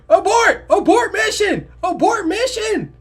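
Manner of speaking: high, loud mock-emergency shouting; panicked command. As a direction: yell the retreat in hammed-up panic. Acts out calling off the plan.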